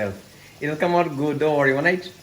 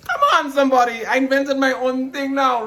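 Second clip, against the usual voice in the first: high, loud triumphant shout-out; proud loud call; 10 semitones higher, 6 dB louder than usual. Real, not acted. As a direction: hype yourself up loudly.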